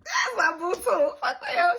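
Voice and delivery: high, loud laughing shout-out. Real, not acted. Vlogger shouting out her boo, laughing. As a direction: shout him out through laughter.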